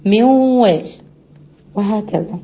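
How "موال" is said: موال raised with a nasal hold on مو; nasal demo. Shown as a demonstration. Teacher repeating the example, higher and louder. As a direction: sing out موال with ghunna. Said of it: "شوف بقول مو".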